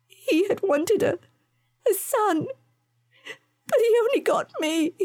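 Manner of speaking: high, faltering, tear-choked; halting tearful confession. A narrator's reading. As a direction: push each word out through the hurt. Joanna confessing her hurt.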